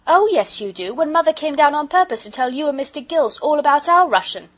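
put-on high, loud contradicting child; insistent child.

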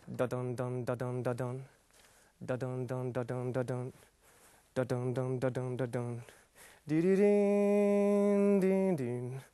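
low hummed da-dun beat; Terminator theme hummed. Retold or imitated. Comic hums the Terminator theme, low and rhythmic. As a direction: hum the movie theme low and steady. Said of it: "från Arnold Schwarzenegger".

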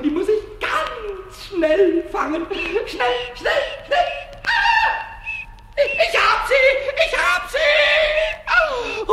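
rising to high triumphant squeals; frantic excitement. Acted. Pumuckl pounces and catches the mouse, high and frantic. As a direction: build from urgency to a squealed catch.

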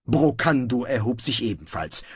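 ordinary delivery, nothing marked

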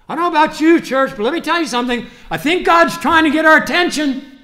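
loud, high, fervent sermon voice; preaching at full force to the congregation; fiery preaching. Acted. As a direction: drive it home to the church.

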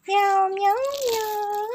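high playful meow; cat sound; imitated meow. Real, not acted. Meowing at kittens.

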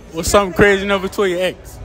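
ordinary talk, nothing marked

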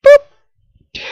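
very high, loud squeaky boop; cartoonish squeaky boop; playful high boop. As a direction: squeak it out like a toy. Playful sound at end.

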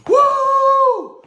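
shrill woo of burning pain; high pained woo. Real, not acted. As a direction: yelp it out, mouth burning. Burning mouth after spicy food.

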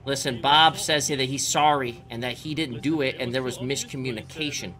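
ordinary talk

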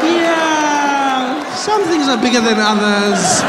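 high, loud, drawn-out hinting; put-out son. Retold or imitated. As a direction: stretch it out pointedly, mock-offended.

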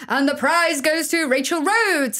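presenter announcing the prize winner, grandly; announcer. Retold or imitated. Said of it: "and they go".

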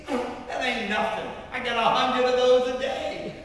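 loud, high, dismissive bragging; hammed-up scoffing boast; brash boast. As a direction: brag at full volume, played for laughs. Acted brash boast, for laughs.

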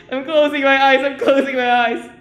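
loud, high panicked cry; panicked shout. Real, not acted. Streamer shouts that she is hiding her eyes, loud panic. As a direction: shout it out in fright.

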